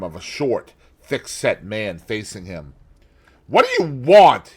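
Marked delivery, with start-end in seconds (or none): 3.5-4.5 frightened, louder and higher gasp; gasped in fright. A narrator's reading. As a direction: gasp it out in sudden fear. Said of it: "he gasped".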